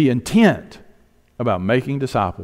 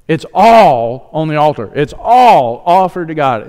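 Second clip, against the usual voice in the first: louder, higher preaching voice that drives each phrase; full-force preaching; 4 semitones higher, 6 dB louder than usual. Acted. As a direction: preach it to the congregation with fire.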